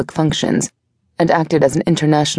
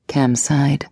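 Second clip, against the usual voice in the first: plain reading voice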